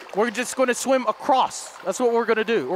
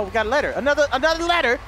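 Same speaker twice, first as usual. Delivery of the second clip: ordinary talk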